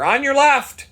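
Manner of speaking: shouted high and loud warning; passing cyclist calling on your left. Retold or imitated. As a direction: call it out to a rider ahead.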